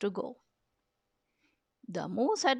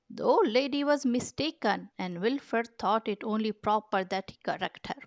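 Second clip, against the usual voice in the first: plain reading voice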